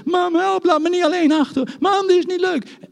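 put-on high, loud, childish plea; child's cry for mama. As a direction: call out like a frightened toddler. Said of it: "net als mij toen ik was".

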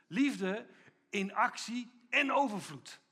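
loud, slow proclamation; ringing, drawn-out declaration; preaching emphasis. Real, not acted. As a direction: drive the words home to the congregation. Preacher's climax line.

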